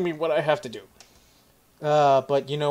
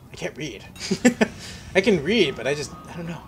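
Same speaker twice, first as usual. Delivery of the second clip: ordinary talk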